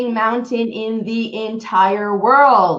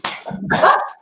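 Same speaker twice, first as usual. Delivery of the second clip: breathy laugh. Real, not acted. Laughs after her story.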